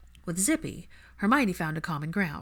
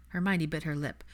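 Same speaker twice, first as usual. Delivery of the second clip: usual speaking voice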